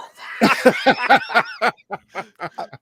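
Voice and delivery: big, high whooping laugh; roaring laugh at a silly rhyme; loud burst of laughter. Real, not acted. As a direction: let out a loud delighted cackle.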